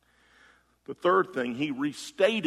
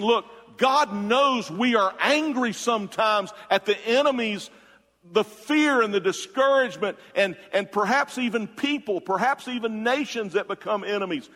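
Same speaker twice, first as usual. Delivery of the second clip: fervent quote; 8 semitones higher, 5 dB louder than usual. Retold or imitated. Preacher voicing commentator, fervent.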